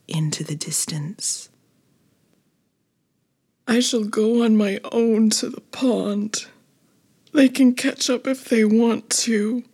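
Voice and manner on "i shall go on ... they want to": higher, put-on little character voice; hurt but determined, in a raised character voice; tearful resolve. A narrator's reading. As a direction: talk yourself into going it alone, higher pitched. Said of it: "she said to herself, wiping the tears".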